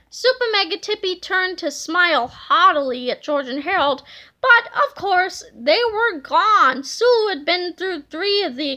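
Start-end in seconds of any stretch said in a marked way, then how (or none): none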